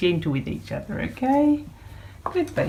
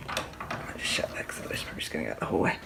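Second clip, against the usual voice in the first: quick whispered aside; 14 semitones lower, 9 dB quieter, faster than usual. Real, not acted. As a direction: whisper it fast, half to yourself. Aside while shutting door.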